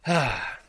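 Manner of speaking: a gentle sigh before responding; demo sigh. Shown as a demonstration. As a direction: pause and sigh it out, slowly. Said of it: "you stop".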